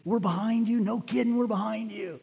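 high-pitched imitation of an encourager; insistent, high-voiced reassurance in caricature; Gene Crail. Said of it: "You know his mannerisms, how he talks?".

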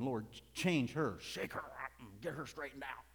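strained, put-on plea to God; mock pleading. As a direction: beg through a tightened voice. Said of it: "Instead of me pleading".

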